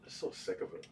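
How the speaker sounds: a quiet, breathy muttered gripe under the breath; exasperated near-whisper; fed-up whisper. Real, not acted. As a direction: whisper it to yourself, sick of it all. Fed-up complaint to chat.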